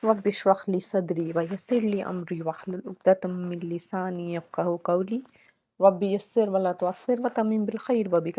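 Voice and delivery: soft, measured Arabic prayer recitation; prayerful recitation. Real, not acted. Teacher opening the Quran lesson with soft prayer. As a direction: recite the opening supplications devoutly.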